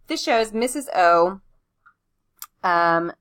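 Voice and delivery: slow, word-by-word dictation; dictating. Shown as a demonstration. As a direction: spell it out as you write. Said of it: "I'm gonna shorten Missus Oblinger".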